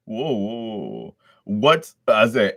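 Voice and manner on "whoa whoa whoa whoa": normal talking voice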